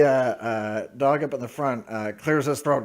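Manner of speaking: ordinary delivery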